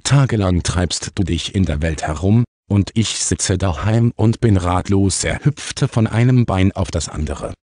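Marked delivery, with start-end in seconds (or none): none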